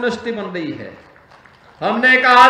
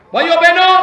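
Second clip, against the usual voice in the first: loud, high call to the crowd; 7 semitones higher, 7 dB louder, faster than usual. Real, not acted. Politician greets the rally crowd, loud high call.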